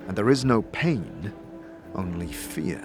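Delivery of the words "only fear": usual speaking voice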